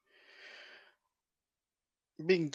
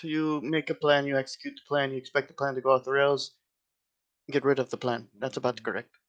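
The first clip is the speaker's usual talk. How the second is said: usual speaking voice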